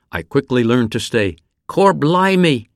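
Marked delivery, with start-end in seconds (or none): 1.7-2.7 higher, louder, slow Cockney "Cor blimey"; Cockney exclamation. Retold or imitated. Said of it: "like my new Cockney friends".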